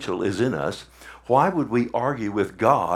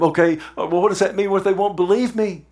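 higher, fretful pleading; complaining Moses; 7 semitones higher, 3 dB louder, faster than usual. Retold or imitated. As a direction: whine your doubts up at God. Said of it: "he just complained to God".

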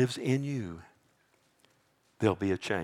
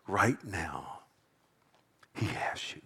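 very soft and low, fading to a whisper; hushed assurance; 5 semitones lower, 10 dB quieter, slower than usual. Real, not acted. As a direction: reassure them in a near whisper. Repeats assurance in a hush.

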